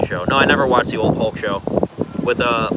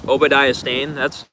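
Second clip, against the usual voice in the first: usual speaking voice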